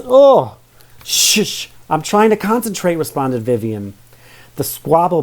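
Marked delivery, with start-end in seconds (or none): 0.0-0.5 loud high disgusted groan; disgusted ugh. Acted. Phil disgusted by honey. 1.0-3.0 high hushing, irritated. Acted. Vivian hushing her brother.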